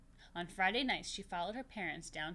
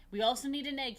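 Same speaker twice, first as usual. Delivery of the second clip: eager Papa; 6 semitones higher, 11 dB louder, faster than usual. Acted. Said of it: "he said".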